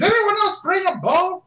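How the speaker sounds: high villager voice; hammed-up high townsfolk cry; panicked townsperson. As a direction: call out high to the crowd. GM voices townspeople shooting at dragon.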